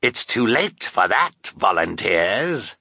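slow, gloating villain; drawn-out villainous triumph; triumphant. A narrator's reading. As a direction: savour the victory, drawing out each word. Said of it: "said Count Olaf in triumph".

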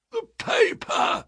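slow, high, strangled croak. A narrator's reading. Revived man behind them, croaking. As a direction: croak it out with barely any voice. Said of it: "croaked a voice behind us".

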